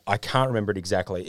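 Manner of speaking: usual speaking voice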